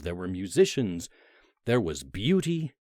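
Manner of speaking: ordinary delivery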